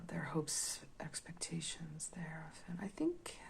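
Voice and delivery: whispering. Real, not acted. Reading the cards softly.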